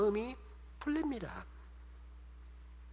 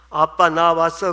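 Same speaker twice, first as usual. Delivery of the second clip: calling to father; 10 dB louder, slower than usual. Retold or imitated. Daughter enters father's room.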